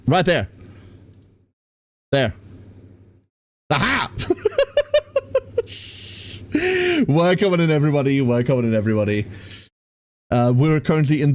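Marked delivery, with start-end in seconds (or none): 4.1-7.0 long high-pitched laugh; high running laughter. Real, not acted. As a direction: burst into a high, extended giggle. Laughs after finding the bird.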